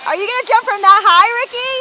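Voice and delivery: high, loud call up to a climber. Real, not acted. Vlogger calls up to Ricky on the ledge, loud and high.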